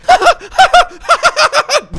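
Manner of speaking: high, loud maniacal cackle; psychopathic laugh. Retold or imitated. Imitating the quest giver turning psychopathic, a maniacal cackle. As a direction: cackle like a villain losing it. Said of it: "she gets a little bit psychopathic".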